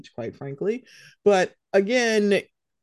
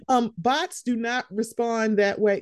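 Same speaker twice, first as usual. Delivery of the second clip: ordinary talk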